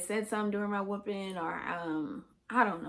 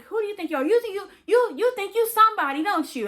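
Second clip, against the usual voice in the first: high, loud, pressing and faster; angry father yelling; 12 semitones higher, 8 dB louder, faster than usual. Retold or imitated. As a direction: hammer the question at a scared kid.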